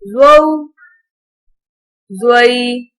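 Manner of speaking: gentle, even Qaida recital, no stretching or jerking; patient teacher's drill reading. Acted.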